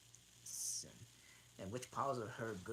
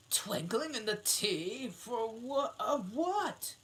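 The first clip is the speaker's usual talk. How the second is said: king voice; 12 semitones higher, 8 dB louder, slower than usual. Acted. King questions.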